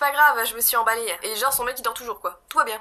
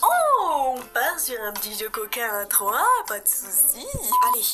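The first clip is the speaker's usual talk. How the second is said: sarcastic quip; 3 semitones higher, slower than usual. Real, not acted. Vlogger mocking the bondage scene, sarcastic. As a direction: tease the twist archly.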